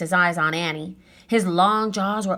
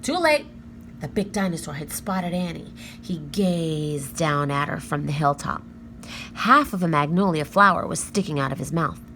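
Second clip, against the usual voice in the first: usual speaking voice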